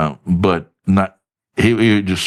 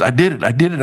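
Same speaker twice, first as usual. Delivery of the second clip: imitated excitement, pitched up; excited caller; 6 semitones higher, faster than usual. Friend reporting his success by phone, all excited. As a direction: announce it like a friend who can't wait to share. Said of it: "he called me up one time all excited".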